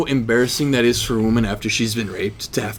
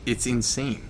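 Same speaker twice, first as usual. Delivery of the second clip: choking up; 12 dB quieter, slower than usual. Acted. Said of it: "I'm choking up a little bit here".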